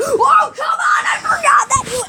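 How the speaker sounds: very high-pitched protesting squeal; dismayed wail. Real, not acted. Gamer realizing he forgot to aim, dismayed. Upset at own mistake.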